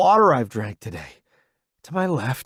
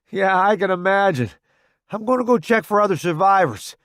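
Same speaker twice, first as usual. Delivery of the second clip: light soldier; 7 semitones higher, 5 dB louder, faster than usual. Acted. Narrator's quoted line.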